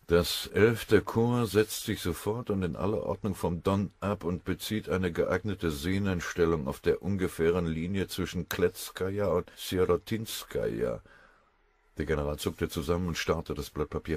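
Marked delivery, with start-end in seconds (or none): none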